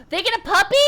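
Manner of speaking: loud high gasping question; surprised gasp. Real, not acted. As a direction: gasp at the news. Gasps at dog news.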